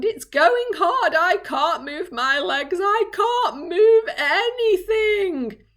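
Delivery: high, slow drawn-out moan. Acted. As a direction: moan it pitifully. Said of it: "he moaned".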